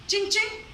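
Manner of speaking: high, bouncy "tching tching" sound effect; playful mock chime. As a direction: ring it out like a joke chime. Mock sound of deal done.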